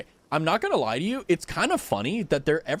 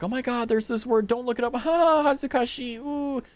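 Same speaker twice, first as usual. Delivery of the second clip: mocking gushing people; 8 semitones higher than usual. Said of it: "people are like".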